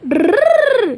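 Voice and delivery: high rolled trilling noise; playful tongue-trill sound effect; imitated trill. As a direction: roll a high, playful "drrr". Toy play, imitated noise.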